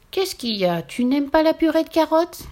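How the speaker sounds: puzzled why the rabbit won't eat; surprised witch. Acted. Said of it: "dit la sorcière étonnée".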